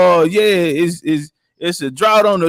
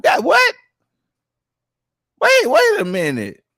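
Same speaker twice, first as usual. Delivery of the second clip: stunned disbelief; 9 semitones higher than usual. Real, not acted. Disbelief at the top claim.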